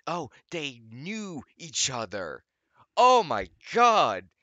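high, loud, slow mock astonishment; sarcastic shock. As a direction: play fake amazement at the obvious. Sarcastic revelation.

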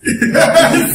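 real loud laugh; sudden laughing outburst. Real, not acted. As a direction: burst of high laughter. Laughs at the translated reply.

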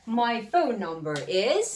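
very slow, each word laid out; slow model sentence. Shown as a demonstration. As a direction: model it slowly for repeating. (آهسته و شمرده برای تکرار)

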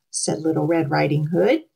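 ordinary delivery, nothing marked

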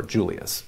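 Julius with an initial J sound; English pronunciation. Shown as a demonstration. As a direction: say Julius as JOO-lee-us. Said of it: "depending on how they want to say it".

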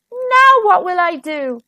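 high, plaintive storybook groan; despairing but lightly acted. A narrator's reading. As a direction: moan it in dismay. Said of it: "Wilf groaned".

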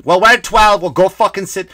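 acted fed-up outburst; irritated retort. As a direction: fire it back at bumbling latecomers. Acted irritated exchange over seats.

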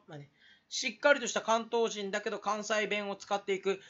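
usual speaking voice

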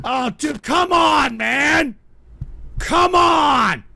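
high, loud drawn-out protest; exasperated shout. Real, not acted. Host protesting a troll's message, exasperated shout. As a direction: holler in disgusted protest.

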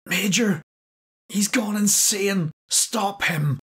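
cornered man begging the major to stop Klaus; terrified plea. Acted. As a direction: beg for help with rising panic. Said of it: "Rudy's voice was thin and hoarse".

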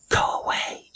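voiceless whisper, no tone at all. A narrator's reading. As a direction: breathe it out urgently. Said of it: "she whispered".

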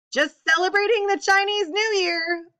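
loud, high gleeful shout. A narrator's reading. Otis joking to the diners about the bomb, shouting. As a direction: crow it out over the noise. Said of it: "Otis Amber shouted".

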